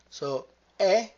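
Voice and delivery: "è" said as an open e, loud and high. Shown as a demonstration. Teacher stressing the open "è" to the class. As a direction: open wide on "è". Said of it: "È, open".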